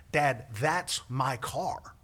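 slow, word-by-word insistence; retold slow, pointed correction; emphatic. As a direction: spell it out for a parent. Spells it out to father.

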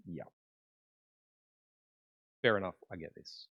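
low, soft dawning realisation; subdued acceptance. Retold or imitated. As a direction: concede it quietly, as it sinks in. Peter's realisation, acted low.

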